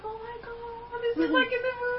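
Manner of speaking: high, soft movie-romance gush; dreamy, high-pitched mock swooning. Swept up in a romantic moment.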